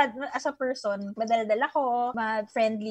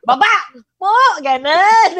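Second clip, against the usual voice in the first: laughing mock order; 3 semitones higher, 6 dB louder than usual. Quoting driver ordering her out.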